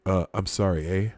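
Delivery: lightly put-on Canadian accent; a hint of Canadian apology; mock Canadian. Host teasing a Canadian viewer, mock Canadian accent. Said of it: "one of those Canadian guys".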